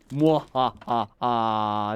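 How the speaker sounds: sneering panto villain. Pretend. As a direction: cackle like a pantomime baddie. Spooky Halloween sign-off cackle.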